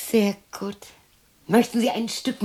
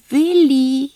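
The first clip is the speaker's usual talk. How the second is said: loud, high call to Willi; 5 semitones higher, 9 dB louder than usual. Acted. Woman calling out loud for Willi across the garden.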